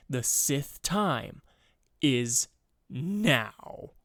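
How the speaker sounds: dramatic line, voice cracking; slow villain line, voice breaking. Acted. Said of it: "that voice crack was awful".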